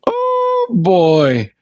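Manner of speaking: retold knowing reaction. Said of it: "I'm like".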